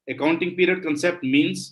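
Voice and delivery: slow, deliberate dictation of a definition. Shown as a demonstration. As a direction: read it out word by word for notes.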